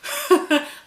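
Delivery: sudden high laugh; burst of laughter. Real, not acted. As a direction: laugh out loud at yourself. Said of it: "well, that's upside down".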